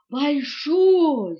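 loud, stretched emphasis; proud announcement. A narrator's reading. Elephant reporting the greeting proudly. (громко, с восторгом)

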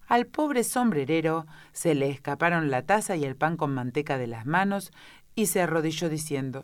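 plain reading voice

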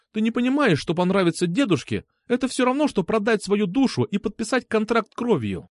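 put-on high, agitated girl's rant; exasperated. A narrator's reading. Girl explaining grandpa's approval is dangerous, exasperated. Acted quarrel, girl still outraged.

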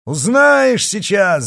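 high, loud, drawn-out yell; roaring. A narrator's reading. Horsemen answer the Gascon together, roaring. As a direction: roar it as a threat. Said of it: "заревели всадники хором".

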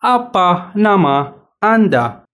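Apa nama Anda said very slowly, word by word; slow teacher demo; slow model phrase. Shown as a demonstration. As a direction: spell it out slowly for learners to repeat. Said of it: "سهل جدًا".

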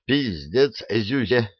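usual speaking voice

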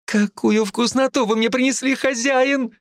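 high, eager little monster; squeaky greedy fairy; fairy creature voice. Acted. Toothy fairy delighted by prey. (высокий жадный голосок феи)

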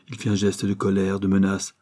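ordinary delivery, nothing marked

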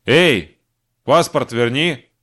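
loud, slow call after him; shouting. Acted. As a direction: shout it across the crowd. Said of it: "крикнула ему женщина-кошка".

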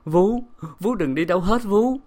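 high-pitched anguished call; frantic, imploring cry; desperate pleading. A narrator's reading. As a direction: beg her nanny not to leave. Mistress begs nanny not to leave.

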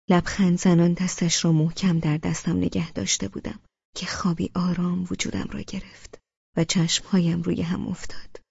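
usual speaking voice

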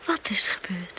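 anxious whisper; frightened whisper. Acted. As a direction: ask it under your breath, afraid.

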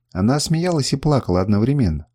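plain reading voice

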